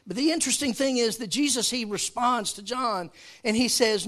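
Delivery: loud, high emphatic preaching; full-force preaching. Real, not acted. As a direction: drive the point home to the church.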